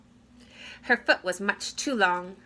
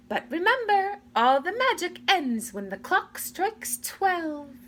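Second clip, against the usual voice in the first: fairy godmother warning; 9 semitones higher than usual. A narrator's reading. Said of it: "said the fairy godmother".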